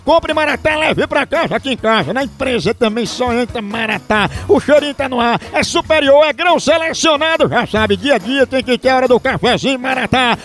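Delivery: lively radio ad read. Acted. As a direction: sell the coffee brightly. Reads a coffee advert. (leitura de comercial de rádio)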